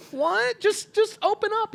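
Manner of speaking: very high, mock-concerned voice; earnest pleading. Sketch bystander urging the bully to share, earnest pleading.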